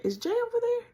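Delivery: very high, light sing-song; forced playful. Retold or imitated. Asking mom about her husband, very high and playful. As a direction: sound carefree while fishing for news. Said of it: "the most playful voice I could muster".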